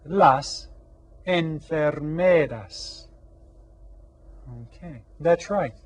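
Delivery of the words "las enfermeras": "enfermeras" drawn out for repetition; slow model. Shown as a demonstration. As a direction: model "enfermeras" slowly for everyone to echo. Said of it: "Repitan todos".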